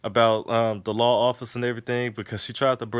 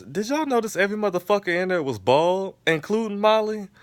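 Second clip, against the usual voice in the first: amused disbelief; 10 semitones higher, 3 dB louder than usual. Real, not acted. Incredulous aside to audience.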